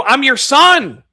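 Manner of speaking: high slowed son explaining to his mother; son answering. Retold or imitated. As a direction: say it patiently but loud.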